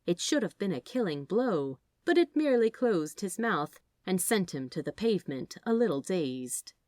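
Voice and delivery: usual speaking voice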